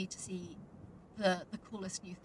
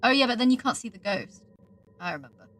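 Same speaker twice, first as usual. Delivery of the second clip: normal talking voice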